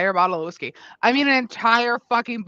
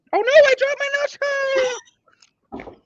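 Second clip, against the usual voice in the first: alarmed squeal; 3 dB louder, much slower than usual. Real, not acted. Sudden nacho drop.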